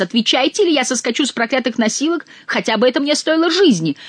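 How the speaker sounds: high, strained narrated cry. A narrator's reading. Count threatening to leap from the litter, high cry. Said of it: "кричал голос".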